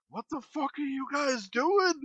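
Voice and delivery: a groggy dad woken up, demanding answers; angry dad. Retold or imitated. Neighbors woke the dad, mild mock yell. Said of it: "and he's just like".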